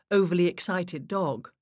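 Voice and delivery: plain reading voice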